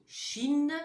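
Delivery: "Chine" said loud, with a clear n; example word. Shown as a demonstration. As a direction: show a word without the sound. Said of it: "le pays".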